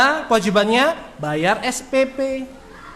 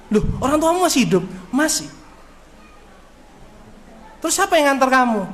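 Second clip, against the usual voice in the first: surprised teacher; 3 semitones higher, faster than usual. Retold or imitated. Ustadz surprised at student.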